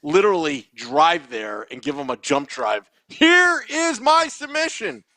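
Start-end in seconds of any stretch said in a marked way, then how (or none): 3.1-5.0 high, slow proclamation; announcer pitch. Acted. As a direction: present it grandly, drawing out each word. Mock submission to contest, acted announcement.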